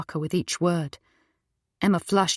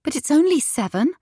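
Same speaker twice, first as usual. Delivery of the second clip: raised pleading wife's voice; a hint of a startled young wife; wife's protest; 9 semitones higher, 3 dB louder than usual. Wife protesting to husband about the time, raised.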